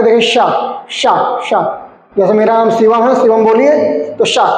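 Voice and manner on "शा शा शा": "शा" with the tongue touching the palate; palatal sha. Shown as a demonstration. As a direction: say "शा" as a palatal sh, repeated separately. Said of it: "आपकी जबान कहाँ पे touch करेगी".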